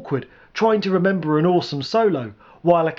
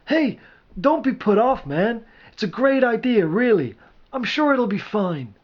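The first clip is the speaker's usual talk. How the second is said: higher-pitched, coaxing friend; pitched-up reassurance; relenting encouragement; 7 semitones higher than usual. A narrator's reading. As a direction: cheer on a disheartened pal. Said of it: "Mangus relented a little".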